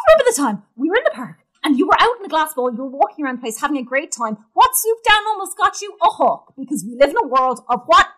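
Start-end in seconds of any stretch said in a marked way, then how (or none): none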